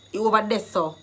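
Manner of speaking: "there" turned to Jamaican "deh"; Jamaican Patois. Shown as a demonstration. Teacher translating a direction for learners, in Jamaican Patois. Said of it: "I've given you the Jamaican dialect".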